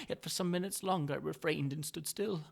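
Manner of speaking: plain reading voice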